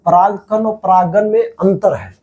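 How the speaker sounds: slow, deliberate stress on परागकण and परागन; slow emphatic point. Shown as a demonstration. Teacher warns students, slowly stressing परागकण और परागन. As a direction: spell out the difference word by word. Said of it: "देखिए ध्यान रहे बाबू".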